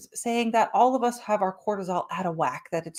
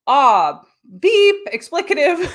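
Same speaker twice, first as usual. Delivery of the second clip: louder, higher mock panic cry; panicked yelp; 5 semitones higher, 7 dB louder, slower than usual. Nervous system freaking out at the boss's message, mock panicked yelp. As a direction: yelp like a jolt of alarm.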